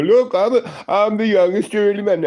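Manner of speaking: high, loud, puffed-up boast; mock-arrogant bragging voice; bragging dickhead. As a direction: crow about it like a cocky jerk. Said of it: "and I'm not a dickhead".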